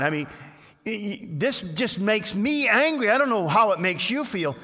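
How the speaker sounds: hammed-up indignation to stir up the king; indignant advisors. Advisors working the king up with mock outrage.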